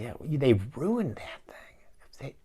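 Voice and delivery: slow, breathy whisper; a slow hushed confession that they ruined it; whispered aside. Real, not acted. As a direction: lean in and whisper the verdict slowly. Conspiratorial aside about the movie.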